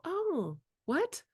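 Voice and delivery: the wrong, clueless adult response; mock baffled. Shown as a demonstration. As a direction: pretend not to get why they're yelling. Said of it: "If a kid yells at me and I go".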